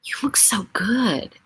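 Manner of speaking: slow, whispered compliment; mock whisper. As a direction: imitate people whispering a compliment to the grieving. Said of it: "they go".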